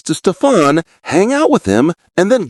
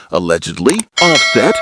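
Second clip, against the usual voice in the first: ordinary talk, nothing marked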